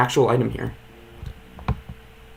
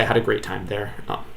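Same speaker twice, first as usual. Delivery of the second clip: normal talking voice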